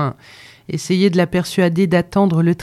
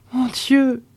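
"mon Dieu!" pitched up high in anguish; "mon Dieu!" rising into a pained, higher cry; despairing cry; 8 semitones higher than usual. A narrator's reading. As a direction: let the second "mon Dieu!" break out higher. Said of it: "fit à part soi la voyageuse".

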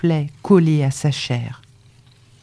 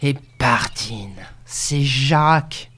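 slow, emphatic exclamation of discovery; sudden realisation; 3 semitones lower, much slower than usual. A narrator's reading. Farmer hitting on the lover's name, emphatic exclamation. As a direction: draw out each word as it dawns on you. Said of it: "l'homme s'écria".

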